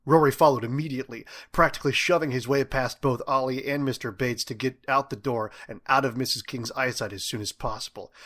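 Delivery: ordinary delivery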